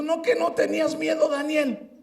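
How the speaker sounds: teasing father. Retold or imitated. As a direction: rub it in playfully. (papá burlón)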